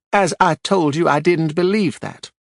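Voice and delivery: a prim widow spreading scandal on the stand; Marjorie, gossipy widow. A narrator's reading.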